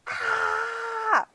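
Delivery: high-pitched playful mock scream; a kid-friendly hammed-up scream. Shown as a demonstration. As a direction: let out a shrill pretend yell. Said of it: "starts screaming really loud".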